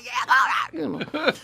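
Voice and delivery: high, breathy hyped exclamation. Retold or imitated. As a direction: shout it out carelessly. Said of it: "I'm like".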